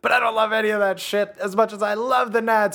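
high loud bro cheer; frat bro. Pretend. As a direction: pump up the boys.

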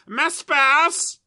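drawn-out, high, booming catchphrase; amazing character voice. Acted. Podcaster shows off a character to co-host, hammed-up catchphrase. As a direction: belt out the catchphrase, stretched and high. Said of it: "should I do some of my amazing characters".

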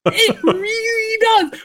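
high, laughing agreement through a giggle; real giggle breaking into the words; giggling. Real, not acted. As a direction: still laughing as you agree. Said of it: "it made me giggle".

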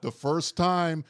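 slow, high mock-plaintive plea. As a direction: stretch it out, pleading like the proctor. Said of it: "like, plaintive".

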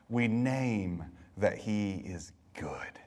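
slow, hushed whisper. Real, not acted. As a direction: lean in and breathe it out slowly. Intimate quiet confession.

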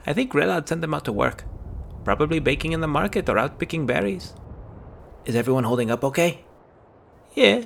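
put-on two young friends trading worried lines; lightly voiced kids.

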